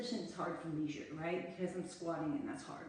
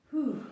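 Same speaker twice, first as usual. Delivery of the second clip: breathy exhale; 6 semitones higher, 7 dB louder than usual. Real, not acted. Catching breath after interval.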